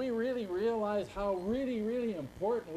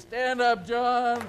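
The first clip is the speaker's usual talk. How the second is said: loud, higher call across the room; calling to John in the crowd, loud and raised; 5 semitones higher, 11 dB louder, much slower than usual. Real, not acted. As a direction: summon him to his feet loudly. Calling to man in audience.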